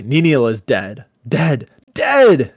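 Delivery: building to a high, loud cry; theatrical outburst, climbing in pitch and volume; angry insistence. Acted. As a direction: hammer the word harder each time, rising. Brandir's angry dialogue, read dramatically.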